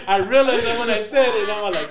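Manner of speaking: high, loud talk through laughter; cracking up while admitting the slip; real laughter. Real, not acted. Guest caught by an accidental double meaning, laughing hard.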